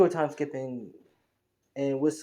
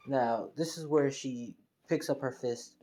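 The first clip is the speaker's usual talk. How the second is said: ordinary delivery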